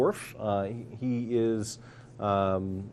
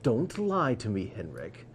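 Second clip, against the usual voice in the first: stern warning; slower than usual. Acted. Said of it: "she narrows her eyes and says".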